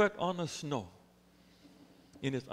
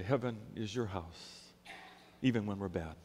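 tender promise; 7 semitones lower, 7 dB quieter than usual. Acted. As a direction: gently deliver God's welcome. Preacher voicing God's promise.

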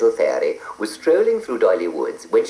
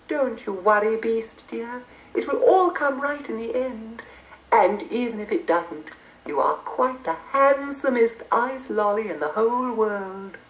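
high, soft kind fairy; tender fairy comfort; 3 dB quieter than usual. Acted.

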